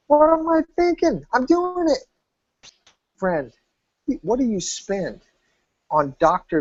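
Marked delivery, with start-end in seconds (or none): 0.1-2.0 excited decision. Retold or imitated. Client's decision voiced in story.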